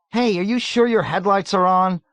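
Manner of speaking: high, loud call forward. A narrator's reading. Nately calling out to the driver, loud and high. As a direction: call out to the front seat. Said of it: "Nately called out".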